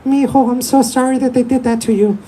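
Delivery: high, sweet, pitied mom voice. Retold or imitated. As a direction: coo like a doting mother. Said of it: "She's like".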